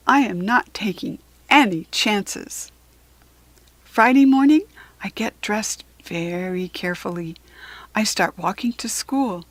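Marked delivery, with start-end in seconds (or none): none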